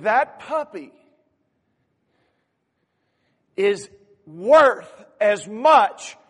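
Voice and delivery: slow, loud, deliberate words; earnest insistence. Acted. Boy to store owner, insisting the crippled puppy has worth, slow and loud. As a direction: weigh every word with conviction.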